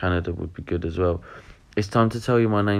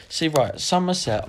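high mock exclamation; 9 semitones higher than usual. Exaggerated high repeat of Somerset.

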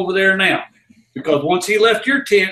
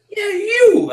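calling back. Retold or imitated. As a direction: answer with a pointed holler. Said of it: "I'd point to him".

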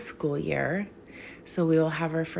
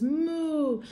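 high playful moo; a sing-song moo for kids; mock cow moo; 9 semitones higher than usual. Animal sound in farm rhyme.

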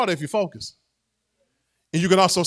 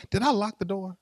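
mock panic; 10 semitones lower, 7 dB quieter than usual. Worshipper panics about unlocked door.

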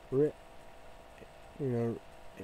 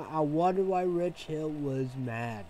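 usual speaking voice

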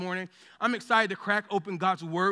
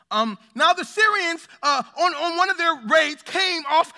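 measured, raised voice reading the Bible passage; scripture reading; 2 semitones higher, 4 dB louder than usual. Acted. Pastor reading Second Kings to the congregation, solemn and raised. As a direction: read it out like holy text to the church.

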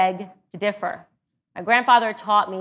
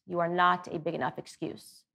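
softer, hushed repetition letting "not" sink in; quiet repeat; 2 semitones lower, 8 dB quieter than usual. Shown as a demonstration. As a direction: say it again softly so it lands. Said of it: "for all of us is you are not a big enough excuse".

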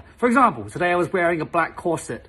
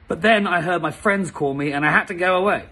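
normal talking voice

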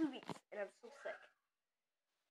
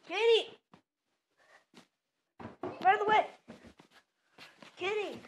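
calling out; 6 semitones higher, 9 dB louder than usual. Real, not acted. Calling the cat loudly.

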